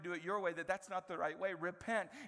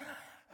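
mock gasp; 8 dB quieter than usual. Mock shock at telling everyone.

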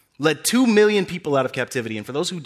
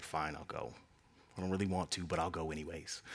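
much lower and softer, grudging; reluctant mutter; 14 semitones lower, 19 dB quieter than usual. Acted. Moses grumbling back to God, low and grudging. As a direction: sigh it out like a sulky kid.

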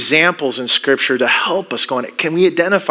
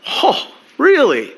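mock disbelief; 7 semitones higher, slower than usual. Mocking King James verse.

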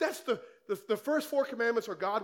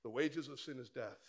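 softer, dropped low and hushed; quiet restatement; 8 semitones lower, 10 dB quieter than usual. Real, not acted. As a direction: let "The wages of sin is death" fall quietly. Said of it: "repeated scripture, quieter".

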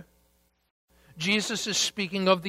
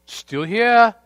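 higher and louder, a touch of wonder; mock amazement; 5 semitones higher, 7 dB louder than usual. Disciples looking up at heaven, lightly amazed. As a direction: voice the disciples finding the world still standing. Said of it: "dramatic pause".

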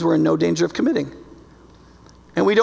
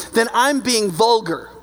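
ordinary delivery

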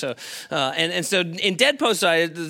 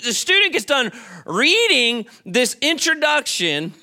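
usual speaking voice

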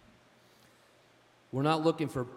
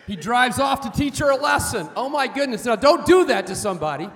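loud, raised-pitch mock dismay at the punchline; exclaiming loudly; 6 semitones higher, 10 dB louder than usual. Real, not acted. Preacher warning the congregation after his joke, loud exclamation. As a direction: shout it out over the crowd's laughter.

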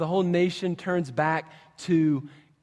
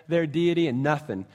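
normal talking voice

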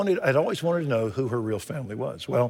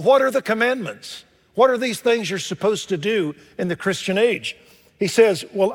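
loud, high pulpit voice; full-force preaching; 7 semitones higher, 4 dB louder than usual. Acted. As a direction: drive the verse home to the congregation.

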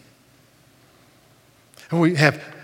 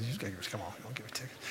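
muttered aside; 3 semitones lower, 16 dB quieter, faster than usual. Retold or imitated. Muttering to passing policeman.

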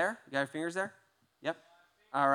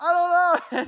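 helpless cry; 14 semitones higher, 12 dB louder, slower than usual. Retold or imitated. Retold panic at car.

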